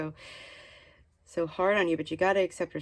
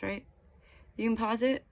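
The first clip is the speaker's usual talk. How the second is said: ordinary delivery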